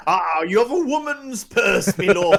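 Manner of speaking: high, loud mocking pirate captain. Captain taunts the player about the purse, loud mocking sneer. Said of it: "the Captain snatches it from your hand and says".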